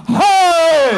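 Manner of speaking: sudden high shouted cry; robbers' war cry. Acted. Robbers charging the family with a loud shout. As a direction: bellow it like charging bandits.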